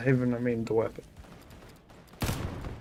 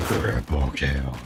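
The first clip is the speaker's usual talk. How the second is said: much lower, slow announcer boom; deep announcer voice; 13 semitones lower, slower than usual. Shown as a demonstration. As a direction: drop low and drag it out like a game announcer. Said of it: "I could sound like an Unreal announcer".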